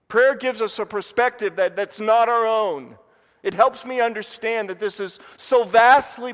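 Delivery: building preacher's fervor; fervent preaching. Acted. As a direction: drive the point home to the congregation. Preaching at full force.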